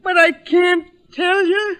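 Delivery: high, pleading and desperate; a mother cornered, begging to be spared; anguished refusal. Acted. Mother pressed to choose son.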